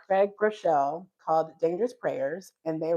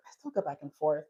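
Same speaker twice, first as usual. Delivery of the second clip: lower, softer aside; low admission; 6 semitones lower, 6 dB quieter than usual. Real, not acted. Speaker admitting her own wavering, quietly. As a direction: drop it to a quiet confession.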